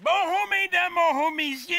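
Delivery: high, hammed-up greeting voice; Gaétan Dantan. Historic character greeting ladies and gentlemen, high put-on voice. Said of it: "ici, on a Monsieur Gaétan Dantan".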